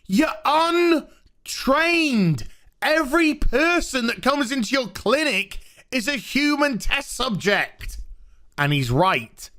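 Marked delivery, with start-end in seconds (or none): 0.1-8.0 a hint of a preachy father talking down; mock lecturing doctor. Jacob scolding Mary about her clinic, mildly mocking lecture voice. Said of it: "And he's like".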